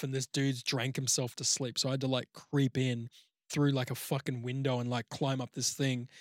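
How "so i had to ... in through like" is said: ordinary talk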